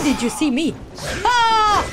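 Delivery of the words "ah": sudden high yelps of alarm; startled shout. Real, not acted. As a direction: shriek in real surprise. Startled by enemy in game.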